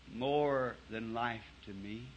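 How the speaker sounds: slow, soft and low, the last words of a dying mother; faint dying mother. Retold or imitated. Preacher voicing his dying mother answering him, faint and slow. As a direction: barely voice it, slowly, a mother on her deathbed.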